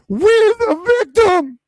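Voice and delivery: shrill mocking squeal of a victim; mock whine. Streamer mocking rivals who claim to be victims, squealing. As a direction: squeal it high and loud to mock them. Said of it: "they're gonna be like".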